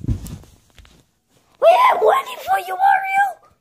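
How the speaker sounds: high, slow shout across the room; calling out. Acted. Studio host shouts to Mario about money, calling out. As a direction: holler for Mario to come back.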